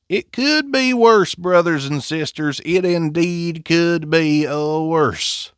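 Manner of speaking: high, slow revival-preacher proclamation; mock preacher. Reviewer defending his accent to brothers and sisters, mock preacher. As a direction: draw it out high, like preaching to the congregation.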